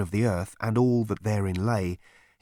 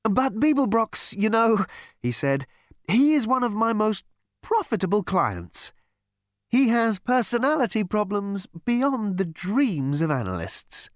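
much higher, sing-song soothing voice; chirpy psychiatrist; 9 semitones higher than usual. A narrator's reading. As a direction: purr reassurance to a violent patient.

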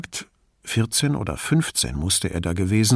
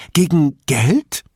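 Gegen Geld higher and louder, rising eagerly; eager old woman; 6 semitones higher, 5 dB louder, slower than usual. Acted. Old woman eager about money.

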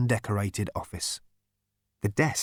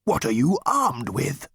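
loud, higher, slow machine roar; disbelieving roar; 5 semitones higher, 4 dB louder than usual. Acted. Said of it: "roared the tank in disbelief".

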